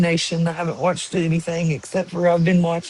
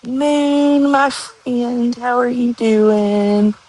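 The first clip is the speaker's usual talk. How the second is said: much higher, slow sing-song to the pet; pet greeting; 6 semitones higher, much slower than usual. Real, not acted. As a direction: greet the animal like a dear friend.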